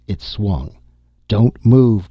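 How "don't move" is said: sharp, loud warning, pitched up high; urgent cry. A narrator's reading. Dorley warns the others as the gun swings, loud urgent cry. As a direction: stop them dead with one loud call. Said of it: "Dorley cried".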